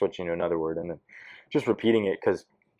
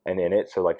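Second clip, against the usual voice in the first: normal talking voice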